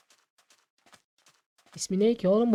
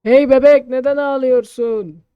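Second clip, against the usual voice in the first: calling out; 5 semitones higher, 11 dB louder, slower than usual. Real, not acted. Player calling to a crying baby, louder and slow. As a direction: call out gently but loud.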